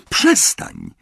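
a contained shriek to stop; narrator's restrained yell; acted cry out. A narrator's reading. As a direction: cry out in protest without much volume. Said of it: "krzyknęła Petunia".

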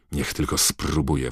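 normal talking voice

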